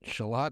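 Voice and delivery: shallot echoed as shuh-LOT; English pronunciation. Retold or imitated. Cook tries the English way, shallot as shuh-LOT. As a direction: repeat shallot with late stress and sounded t. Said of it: "if you're English, it's shallot".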